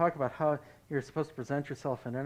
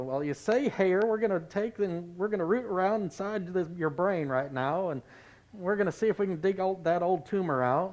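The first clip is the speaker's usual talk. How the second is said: hammed-up Southern accent, higher and louder; exaggerated southern accent; 5 semitones higher, 5 dB louder than usual. Southern surgeon in a comedy bit plans brain surgery, exaggerated Southern accent. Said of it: "doing this very exaggerated southern accent".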